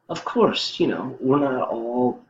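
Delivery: high mock Trump voice; imitating Trump. Vlogger keeps mimicking Trump, high. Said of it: "He goes".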